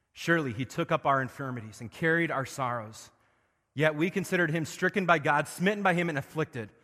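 loud, high, building revival sermon; full-force preaching. Acted. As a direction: preach it out with rising fire. Reads Isaiah at full force.

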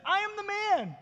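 high-pitched, emphatic insistence; insistent. A narrator's reading. Healed man answering doubting neighbours, insistent. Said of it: "But he himself insisted".

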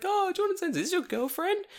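very high child imitation; teasing kid voice. Third graders asking teacher about girlfriend, high teasing voice. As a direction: pipe up like a nosy kid.

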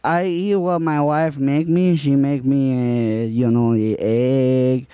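light put-on Spanish accent. Improv scene as Juan.

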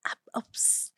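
whispered, hesitant confiding; hushed confession. Acted. Girl secretly telling her sister about blood, hushed.

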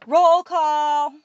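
loud, high, drawn-out call; shouted. A narrator's reading. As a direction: shout it to the whole hall. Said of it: "stood on the table and shouted".

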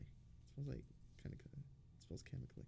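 real whisper, puzzled; a soft, suspicious whisper. Real, not acted. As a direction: hush it while sniffing the air. Noticing a strange smell.

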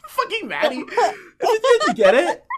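cracking up, high squeals through the words; laughing. Real, not acted. Laughing at her friend copying the saying.

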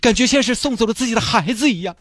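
high, hammed-up sentimental goodbye to the dolphin; tearful farewell. Mr Chen bidding the released dolphin farewell, hammed-up sentimental. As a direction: send the dolphin off like your own child, overplayed.

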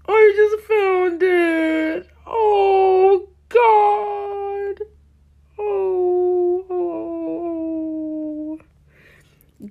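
high, slow, near-crying wail; anguished wail. Real, not acted. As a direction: moan it out in real mortification. Shocked at discovered old account.

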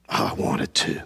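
soft unvoiced admission; whispered confession. Real, not acted. Preacher confesses he wanted to quit, whispering. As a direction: breathe it out quietly.

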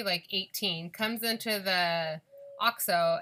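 ordinary talk

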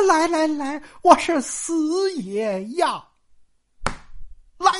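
high, eager acceptance, drawn out at the end; slow, emphatic lovestruck promise; eager vow. Acted. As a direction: swear it like a smitten suitor. Smitten suitor swears to come.

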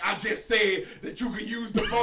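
mock shout, high and loud; exasperated retort. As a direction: blurt it out loudly, imitating an irritated friend. Retold irritated outburst at the woman.

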